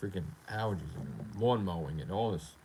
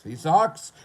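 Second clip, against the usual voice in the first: higher, louder sing-song jeer; mock taunt; 7 semitones higher, 8 dB louder than usual. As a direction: jeer like a playground bully. Taunt said low then high.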